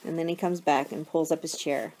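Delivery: ordinary delivery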